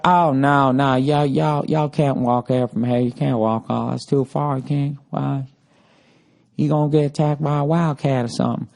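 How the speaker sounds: mock Southern receptionist warning guests; hammed-up Southern hotel lady; Southern accent. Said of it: "I just like the Southern accent".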